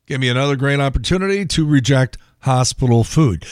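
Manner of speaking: ordinary talk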